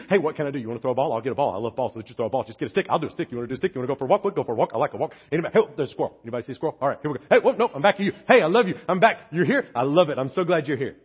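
mock overexcited dog; excited dog voice. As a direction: rattle on like a distracted happy dog. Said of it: "that dog is excited to see you".

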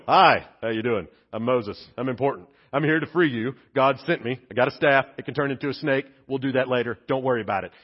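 low, laid-back Moses pitch; casual Moses. Retold or imitated. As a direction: play Moses as an easygoing salesman, voice dropped. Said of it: "if Moses and Aaron show up and go".

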